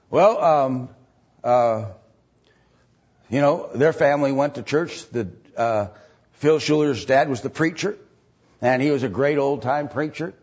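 ordinary talk, nothing marked

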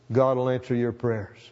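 ordinary talk, nothing marked

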